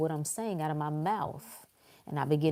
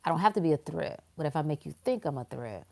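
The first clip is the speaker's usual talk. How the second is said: ordinary talk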